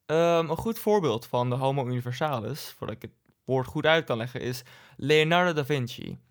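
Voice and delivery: normal talking voice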